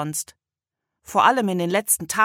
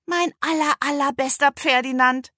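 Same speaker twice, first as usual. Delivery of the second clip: put-on excited girl; 8 semitones higher, 4 dB louder than usual. A narrator's reading. Girl greets her donkey.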